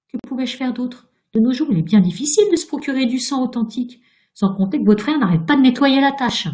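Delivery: high, loud, aggrieved protest; indignant self-defence. A narrator's reading. Ghost excusing the stolen paints to Virginia, indignant self-defence. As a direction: justify yourself testily.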